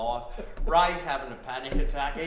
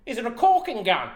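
naive Ray; 9 semitones higher, 8 dB louder, faster than usual. Retold or imitated. Ray quoted naive question.

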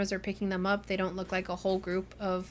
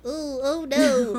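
higher, slow, fretful oh-no; flustered nervous guard; 5 semitones higher, 5 dB louder, much slower than usual. Retold or imitated. Imagining the head of security, in a slow fretful oh-no voice. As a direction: dither like a panicky security head. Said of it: "type of voice".

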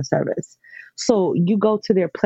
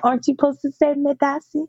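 playful tease; 9 semitones higher than usual. Retold or imitated. Teasing boy about Ghanaian thanks.

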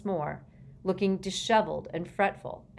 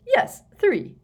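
high, loud, brisk confirmation; brisk minister; 12 semitones higher, 9 dB louder than usual. A narrator's reading. Fudge confirms the dragons to Prime Minister, loud and brisk.

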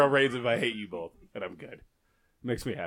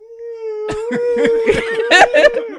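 high loud burst of laughter; 10 dB louder than usual. Real, not acted. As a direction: crack up loudly. Laughing at ambulance joke.

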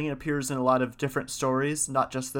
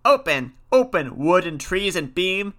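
loud, raised command from the witches; crying out; 5 semitones higher, 5 dB louder than usual. A narrator's reading. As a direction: call out fiercely at a barred door. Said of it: "they cried to the door".

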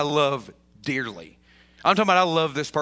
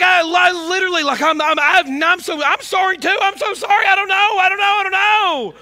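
loud, much higher, stammering apology; flustered shouting; 12 semitones higher, 7 dB louder than usual. Retold or imitated. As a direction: blurt out sorry in a flustered shout. Baffled, flustered reply to crying friend.

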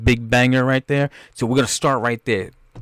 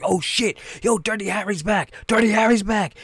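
hyped fan; 10 semitones higher than usual. Retold or imitated. Hyped audience reacting to trailer.

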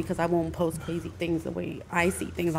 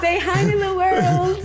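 high, slow shouted greeting; loud hype shout; 12 semitones higher, 5 dB louder, slower than usual. Pretend. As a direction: call out to the whole world. Calling out to the audience.

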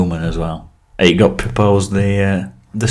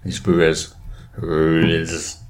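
put-on pirate accent; 3 semitones lower, 6 dB quieter, much slower than usual. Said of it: "It's my pirate impression".

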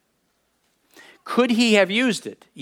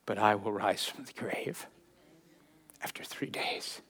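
soft, breathy whisper of hope; hushed whisper; 9 semitones lower, 13 dB quieter than usual. Acted. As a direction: whisper it like a secret promise. Jesus reading promise of rising.

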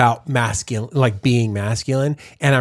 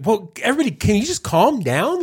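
exasperated; 7 semitones higher than usual. Acted. Exasperated retort to the book.

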